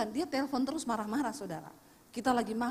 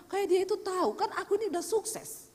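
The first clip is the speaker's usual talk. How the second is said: a hint of a smug, higher voice; mock boasting; 8 semitones higher than usual. Pastor mimicking the son's boastful father, lightly smug. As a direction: lightly send up the know-it-all.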